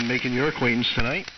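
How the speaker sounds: normal talking voice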